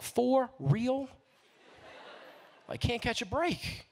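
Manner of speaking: high, incredulous for real; can't believe it's this place again; disbelieving. Retold or imitated. In disbelief at the police station, a high incredulous question.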